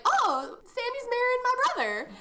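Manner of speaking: squeaky gleeful announcement; mock excited girl. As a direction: blurt out the news like a thrilled teen. Voicing Mary in retold scene.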